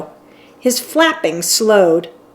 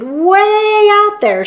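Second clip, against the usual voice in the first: slow, high and insistent; firmly; 12 semitones higher, 4 dB louder, much slower than usual. A narrator's reading. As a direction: lean on each word so nobody can doubt you. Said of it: "she said firmly".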